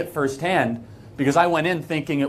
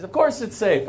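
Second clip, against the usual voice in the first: a touch higher, breezy mock reassurance; lightly voiced Matan's reply; 7 semitones higher than usual. Retelling asking Matan if Hebron is safe, lightly mimicked breezy reassurance. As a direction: toss it off like the unbothered host.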